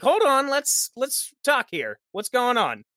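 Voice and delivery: hammed-up plea to stop the fight; mock urgent players. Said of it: "the players are like".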